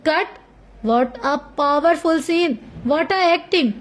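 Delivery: slow, dazed awe over each word; a director lost in the moment of the scene; entranced director. A narrator's reading. Director after the take, slow and dazed with awe. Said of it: "director मदहोश अंदाज में".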